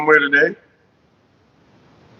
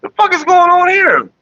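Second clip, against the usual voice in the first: high, loud startled yell; mock outburst; 3 dB louder, slower than usual. Shocked at people acting weird, yelling. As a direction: blurt it out shocked. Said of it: "I was like".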